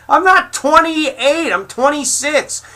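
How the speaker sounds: real furious outburst, pitch way up; furious shouting. Real, not acted. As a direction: blow up over the wrong age, near screaming. Furious reply to age insult.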